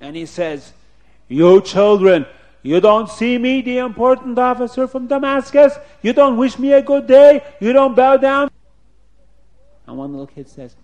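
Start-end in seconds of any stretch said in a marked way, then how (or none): 1.3-8.5 high, loud indignant outburst; angry officer. Acted. Officer scolding children who ignored him, acted angry shouting. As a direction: demand it as an affronted big shot. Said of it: "he got so angry".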